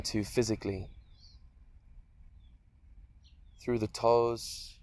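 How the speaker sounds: unhurried meditation voice with long pauses; guided meditation. Acted. Teacher leading listeners through a guided meditation, calm and spacious. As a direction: guide them gently through a body scan, leaving space between phrases.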